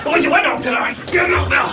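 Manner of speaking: high, exasperated shouting; angry outburst. Acted. Passenger told by the lift to remain calm, angry outburst. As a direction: explode at being told to keep calm.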